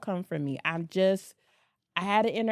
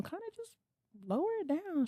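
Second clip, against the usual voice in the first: very soft, high-pitched hint; hushed, delicate request to tone it down; hushing a friend; 9 semitones higher, 7 dB quieter than usual. Retold or imitated. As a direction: gently shush someone in a tiny high voice.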